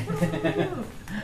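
a rising embarrassed laugh; sheepish laugh. Real, not acted. Laughs about the broken old pen. (tertawa malu)

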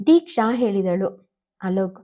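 ordinary delivery

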